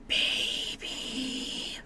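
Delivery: gasp, then a long whispered coo; awed whisper. Real, not acted. Card opener marvelling at a baby card, awed whisper. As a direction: whisper it breathlessly in delight.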